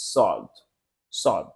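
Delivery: সদ said low and deep from a full, rounded mouth; full-mouth sound. Shown as a demonstration. Teacher demonstrates the heavy সদ to students. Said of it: "আমাদের এই অবস্থা আসবে".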